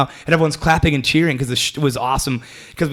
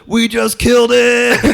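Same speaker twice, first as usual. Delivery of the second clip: high, drawn-out, louder mock victory cry; gleeful triumph; 10 semitones higher, 5 dB louder, slower than usual. Comic recalling his stage success despite guilt, gleeful crowing. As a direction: crow it slowly in a high voice.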